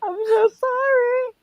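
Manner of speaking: high, drawn-out fake wail; hammed-up weeping condolence; mock sobbing. Mourner faking tears at a funeral with shots, mock sobbing. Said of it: "I might be able to cry at a funeral".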